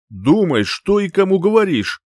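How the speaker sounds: loud, rising angry warning. A narrator's reading. Dragon prince warning the heroine, angry. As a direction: warn with mounting anger. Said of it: "Дракон злился".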